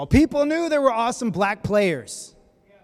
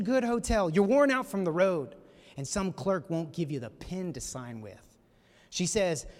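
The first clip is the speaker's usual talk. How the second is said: normal talking voice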